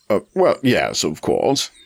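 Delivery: slow, hesitant nervous reply; stall, trembling with nerves; nervous muttering. A narrator's reading. Frightened buyer answering the German, nervous mutter. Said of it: "he muttered".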